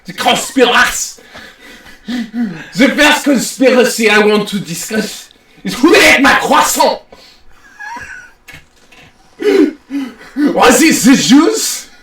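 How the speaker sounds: comic French lecturer; mock-French host announcing his topic; hammy French accent. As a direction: present it in a thick fake French accent. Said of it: "you doing this accent".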